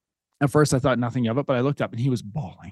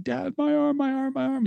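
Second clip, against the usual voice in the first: put-on high whimpering child voice, much higher; imitating crying son; 13 semitones higher than usual. Dad imitating his sobbing son. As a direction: mimic a sobbing kid clutching his arm.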